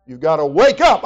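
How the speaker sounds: loud, high-pitched full-force sermon; fiery preaching. Acted. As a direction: preach it hard to the congregation.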